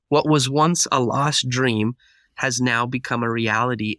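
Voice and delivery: ordinary delivery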